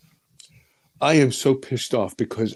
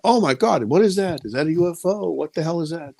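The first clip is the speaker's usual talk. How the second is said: retold amazed crowd; 7 semitones higher than usual. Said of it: "People were saying".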